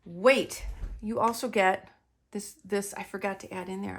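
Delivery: usual speaking voice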